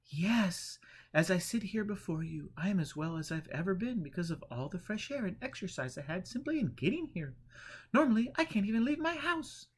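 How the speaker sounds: fearful Eleanor. A narrator's reading. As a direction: beg for help in dread.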